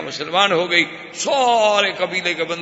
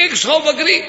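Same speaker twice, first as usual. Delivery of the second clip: high, astonished question; can't believe the gift, ask it high; amazed disbelief; 9 semitones higher, 3 dB louder than usual. Acted.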